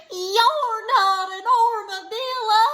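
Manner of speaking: high, loud wailing cry; a disappointed wail howled into the air. A narrator's reading. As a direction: wail it up at the sky in dismay. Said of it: "he wailed".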